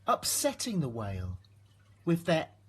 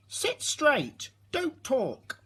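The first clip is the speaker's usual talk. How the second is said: high, strict schoolteacher order; stern teacher; 12 semitones higher, 3 dB louder, slower than usual. A narrator's reading. Teacher tells the class to sit straight, high and stern. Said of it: "telling the class".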